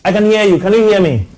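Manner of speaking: loud, high call down a bad phone line; to someone hard of hearing. Real, not acted. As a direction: raise your voice so the caller hears. Caller barely audible on phone line.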